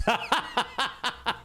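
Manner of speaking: high, rapid cackling laugh; real laughing fit. Real, not acted. Streamer hearing a viewer's cheering story, high amused laugh. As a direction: burst out laughing, high and staccato.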